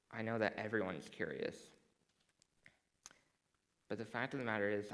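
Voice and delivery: ordinary talk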